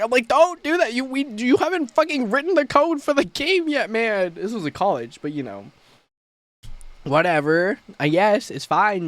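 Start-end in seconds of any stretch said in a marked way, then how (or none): 0.3-4.3 exasperated scolding. Retold or imitated. Scolding a teammate who claims narrative director, exasperated. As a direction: put the overreaching teammate in his place.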